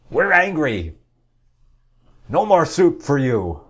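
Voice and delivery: high, loud indignant whine; mock outraged Egyptians. Mocking Egypt's leaders, a high loud indignant rant. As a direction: lampoon a greedy official demanding money. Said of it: "because they say".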